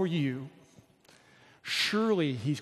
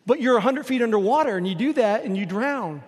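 put-on Texan accent; 4 semitones higher, 6 dB louder than usual. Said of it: "I'm talking like a Texan".

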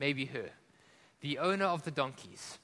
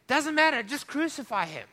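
mob shout; 8 semitones higher, 6 dB louder than usual. Acted. Mob demands crucifixion, shouting.

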